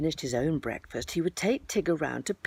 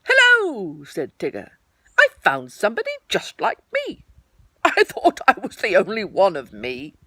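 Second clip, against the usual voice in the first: Tigger voice; 3 semitones higher, 8 dB louder than usual. Acted. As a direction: gush like a tiger thrilled at himself. Tigger delighted at mirror.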